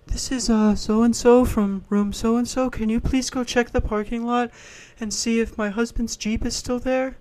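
imitating a crying woman. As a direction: mimic her barely able to speak. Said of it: "This woman is crying".

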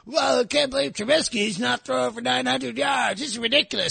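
mock a spoiled fan's complaint; lightly voiced entitled fan in a mock shout; mocking a whiny fan. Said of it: "and then be like".

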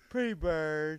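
high pretty bird squawk; parrot voice. Pretend. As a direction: talk like a pet parrot. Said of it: "parrot voice".

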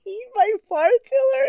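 high, playful taunt; mock insult. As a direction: fling a silly insult. Mock insult shouted in jest.

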